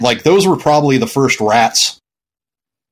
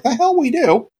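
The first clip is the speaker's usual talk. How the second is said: high, scoffing disbelief; incredulous retort; 14 semitones higher than usual. Retold or imitated. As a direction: squeak out a snarky denial. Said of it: "And I'm like".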